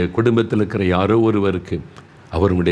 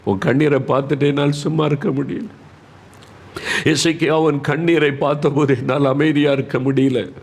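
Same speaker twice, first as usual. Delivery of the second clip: pleading, higher-pitched voice with long choked pauses; overcome with compassion; 6 semitones higher than usual. Acted. God to weeping Hezekiah, overcome with compassion. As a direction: speak as one deeply moved by tears.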